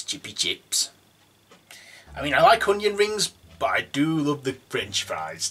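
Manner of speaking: usual speaking voice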